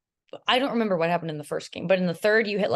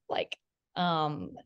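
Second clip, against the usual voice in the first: ordinary talk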